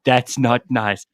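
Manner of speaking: slow, prim mock scold; hint of a prissy assistant; Karen-ish Siri. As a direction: draw it out like a disapproving Karen. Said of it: "your Siri is like a Karen".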